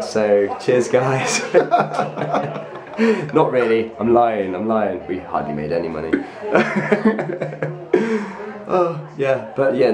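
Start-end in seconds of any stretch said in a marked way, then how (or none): 1.5-3.3 high burst of laughter; laughing. Real, not acted. Jokes about cashing in. 6.2-8.6 high laugh at own joke. Real, not acted. Admits they made no money.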